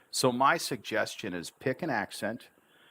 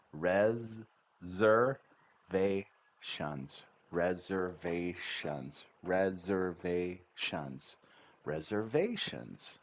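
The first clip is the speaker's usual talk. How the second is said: RESERVATIONS slowly, each syllable given its own tune; drawn-out teaching RESERVATIONS, syllable by syllable; intonation on each syllable; slower than usual. Shown as a demonstration. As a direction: sound out RE-ZER-VA-TIONS up and down. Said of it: "Notice I intonate on each syllable".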